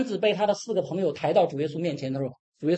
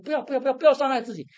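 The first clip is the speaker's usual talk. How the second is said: high, fast, louder cries of don't; urgent pleading; 9 semitones higher, 4 dB louder, much faster than usual. Pretend. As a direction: rush to stop him hurting himself. Stopping the jailer's suicide. (急切高声地劝阻)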